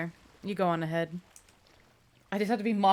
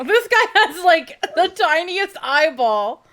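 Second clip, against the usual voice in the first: laughing loud and high, slowing down; cracking up as the words come out; laughing at him; 10 semitones higher, 10 dB louder, slower than usual. Real, not acted. Gamer cracking up at a character's tiny eyeball, loud laughing.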